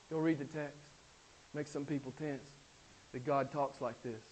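usual speaking voice

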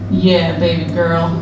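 ordinary talk, nothing marked

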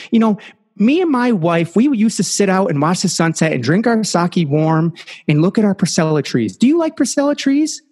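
put-on high, chatty old man voice; little old man. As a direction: play a friendly little old man reminiscing. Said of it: "the little old man goes".

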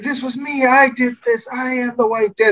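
high-pitched, hammed-up declaration; boastful villain. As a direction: proclaim it like a proud young villainess. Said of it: "the part where she's like".

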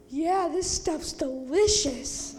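loud, slow, drawn-out approval; mock relish. Camper imitates brother eating ranch and Jell-O, loudly relishing it. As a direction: play up the brother savoring it. Brother's reply acted in a story.

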